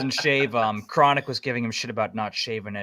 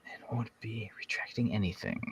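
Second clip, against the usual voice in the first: whisper; 12 dB quieter than usual. Pretend. Reads her post in whisper.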